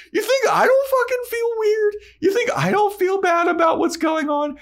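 very high, loud mock outburst; hammed-up vampire boyfriend losing it; fed-up Angel. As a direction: blurt it out in squeaky frustration. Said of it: "Angel gets fed up".

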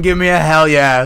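loud wrestler call for a hell yeah; Stone Cold Steve Austin. Retold or imitated. Quoting the wrestler to cheer on fiancée, loud hype. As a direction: hype the crowd like a wrestling star. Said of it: "in the words of Stone Cold Steve Austin".

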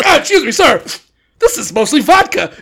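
mock polite drunk dad. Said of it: "if the cop's like".

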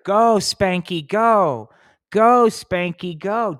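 high, loud, slow cheer chant; cheerleader cheer. Pretend.

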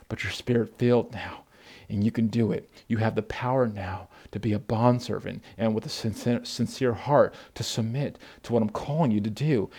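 God softly reassuring a struggling worker; gentle God. Retold or imitated. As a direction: soothe them as a loving God. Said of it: "And God's like".

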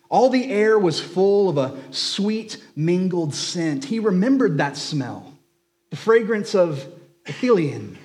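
a preacher lifting the page to life; story reading. A narrator's reading. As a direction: read it like a treasured bedtime story. Reads Tolkien excerpt aloud.

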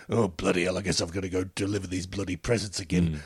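low, grumpy Father Christmas voice; grumbling curmudgeon. Acted. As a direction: grumble like a curmudgeon resigned to the job. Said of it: "just a real curmudgeon in them".

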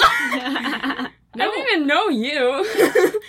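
slow, loud, laughing retort. Retold or imitated. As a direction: laugh through the protest. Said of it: "I'm like".

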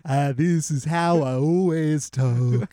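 slow, drawling mock singer voice. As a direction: drag the words out in a caricature. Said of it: "that's how".

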